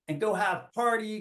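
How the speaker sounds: normal talking voice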